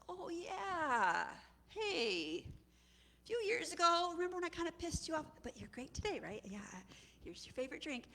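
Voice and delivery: high-pitched, tentative servant; sheepish cupbearer. Acted. Cupbearer sheepishly reminds Pharaoh.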